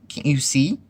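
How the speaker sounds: high, exasperated cry; mild shout. A narrator's reading. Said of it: "shouted Piglet".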